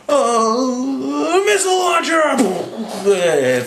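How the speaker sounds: high, slow, drawn-out shouted call; mock battle cry. Nick Fury firing at the planes, with a high, slow hammed-up shout. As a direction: belt it out like an action hero. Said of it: "And he's like".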